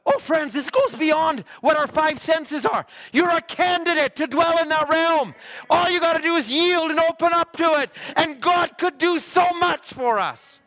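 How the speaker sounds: very high, loud exhortation; shouted preaching climax. Acted. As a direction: cry out to the congregation at full force. Sermon climax.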